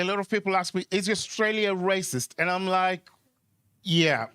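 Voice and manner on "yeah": usual speaking voice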